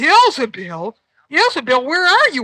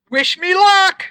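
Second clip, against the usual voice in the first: usual speaking voice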